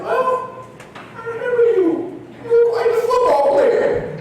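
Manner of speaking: high, slow, fluting professor voice; high-pitched mock professor recognizing a football player; Doctor Scott's voice. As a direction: sound delighted and a bit prim. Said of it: "That's how he talked".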